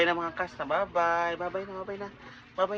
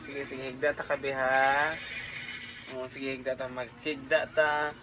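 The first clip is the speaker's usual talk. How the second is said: lulling; 5 semitones lower, 5 dB quieter than usual. Real, not acted. Parent settles the baby to lie down, softly.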